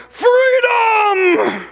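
shouted, defiant rebel cry; rally the troops like a Scottish rebel; William Wallace voice. Acted. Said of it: "in their best William Wallace voice".

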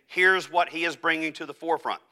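ordinary talk

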